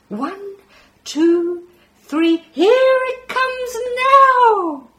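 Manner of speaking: high, loud alien counting down; playful alien. Acted. Alien hurling a muffin through the ear, loud high countdown. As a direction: build up the throw like a gleeful space creature.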